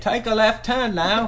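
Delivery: a weak imitation of a navigation voice; GPS voice. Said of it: "I can't do a very good one".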